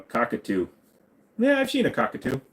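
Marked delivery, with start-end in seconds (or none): 1.4-2.4 high squeaky voice. Pretend. Silly voice about cockatoo.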